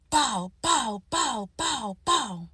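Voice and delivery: rapid run of sharp "bow" sounds, each falling in pitch; spoken gunshot noises in quick succession; imitated gunshots. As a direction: mimic gunfire with your voice, pow after pow. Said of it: "I hear gunshots".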